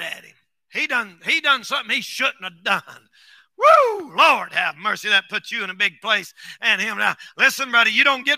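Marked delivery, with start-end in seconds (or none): 3.6-4.1 sharp high woo; high preaching whoop. Real, not acted. Preacher whooping to his church in amazement.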